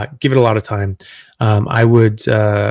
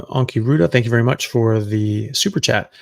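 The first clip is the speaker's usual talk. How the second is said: ordinary delivery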